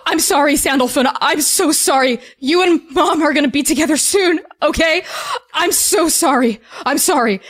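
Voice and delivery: acted weeping father saying sorry; sobbing apology. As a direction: gasp between apologies as if crying. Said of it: "He is sobbing".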